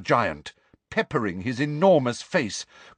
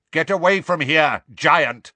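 loud, raised warning shout; calling out; 4 semitones higher, 5 dB louder than usual. A narrator's reading. Centaur warning off a giant, loud commanding call. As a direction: order the intruder off, high and loud. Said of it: "called Megorian".

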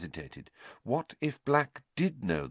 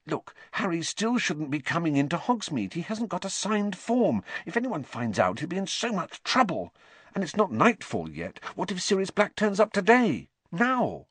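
high, anxious fretting; worried girl; 10 semitones higher than usual. A narrator's reading. Hermione fretting to Ron about Harry, anxious. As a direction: voice rising with worry.

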